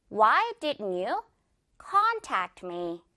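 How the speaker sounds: slow question with a strong CON in contact; stress on CON. Shown as a demonstration. Teacher models the example question, slow with front-stressed contact. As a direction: stretch con, let tact me fall low. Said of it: "con 부분의 강세".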